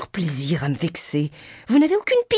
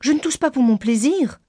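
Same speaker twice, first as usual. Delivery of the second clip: high-pitched testy retort; peevish; 8 semitones higher than usual. A narrator's reading. Kitty answers her father's remark on her coughing, peevish. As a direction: answer back in a higher, put-out voice. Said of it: "répliqua Kitty avec humeur".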